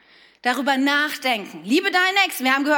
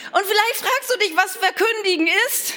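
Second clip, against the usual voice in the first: ordinary talk, nothing marked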